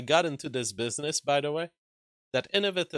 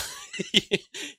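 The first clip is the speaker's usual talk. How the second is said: high burst of laughter. Real, not acted. Laughing at absurd reply.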